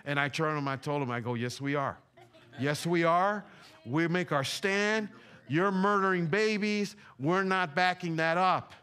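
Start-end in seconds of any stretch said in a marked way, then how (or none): 2.6-3.4 firmer, emphatic retort, rising at the end; defiant retort. Retold or imitated. Repeats retort with more force. 3.8-8.7 slow, higher-pitched preacher's declaration; defiant preaching. Retold or imitated. Preacher retells his defiant stand.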